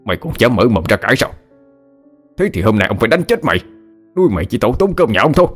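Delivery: fast angry tirade at a servant, pitch rising; acted rage without extra volume; enraged threats. A narrator's reading. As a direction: master berating the maid, gathering speed. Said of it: "lão Khánh nổi cơn thịnh nộ".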